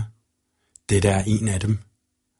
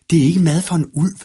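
slightly higher, quick and insistent; a hint of an eager young wolf, pitched up; lightly voiced young wolf; 7 semitones higher, 4 dB louder, faster than usual. A narrator's reading. Young wolf refuses mushrooms, lightly voiced and insistent.

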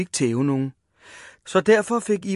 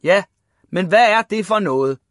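raised, loud indignant question; shouted scolding; 4 semitones higher, 7 dB louder than usual. A narrator's reading. Father thumping the table at Ivan, shouting. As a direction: demand it, pounding the table. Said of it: "råbte hans far og slog i bordet".

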